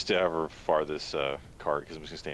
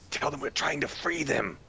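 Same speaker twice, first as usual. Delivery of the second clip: urgent telepathic call; 11 semitones higher than usual. Acted. Telepathic message to captives.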